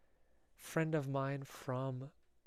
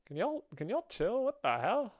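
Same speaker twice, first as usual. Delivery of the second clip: high-pitched, swooping exasperation; exasperated protest; 9 semitones higher, 3 dB louder than usual. Real, not acted. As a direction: plead with the chat in high-pitched disbelief. Protesting at suggestive chat messages.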